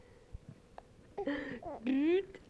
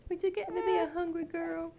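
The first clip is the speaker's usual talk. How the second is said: normal talking voice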